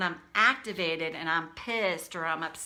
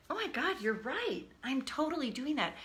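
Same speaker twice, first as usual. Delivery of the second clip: retold, higher-pitched sudden agreement; astonished admission; 6 semitones higher than usual. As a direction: act out owning up in amazement. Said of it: "what was astonishing to me is I was like".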